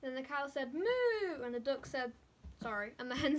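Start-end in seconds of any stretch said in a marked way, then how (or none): 0.7-1.4 loud, high playful moo; cow moo. A narrator's reading. Cow calling in the farmyard, big playful moo. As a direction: sing out a big moo for the kids.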